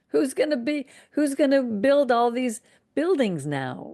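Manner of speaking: a lightly voiced fretful Pharaoh; voicing Pharaoh's court.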